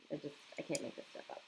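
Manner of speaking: breathy whispered aside to herself. Real, not acted. Whispering an aside to viewers about coffee scenes. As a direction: whisper it to yourself, half laughing at her habit.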